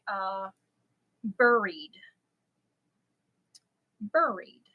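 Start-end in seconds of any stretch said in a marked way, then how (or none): 1.2-4.6 "buried" said BUR-eed, first syllable rhyming with "fur"; own pronunciation of buried. Shown as a demonstration. Telling viewers the word the daughter cracks up at, "buried" as BUR-eed. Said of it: "cracks up when I say".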